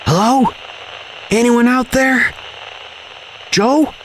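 high-pitched, searching radio call. A narrator's reading. Calling into a silent radio.

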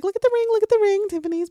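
high, rushed ring-flaunting; mock excited showing off. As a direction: show off the ring fast and high, imitating her.

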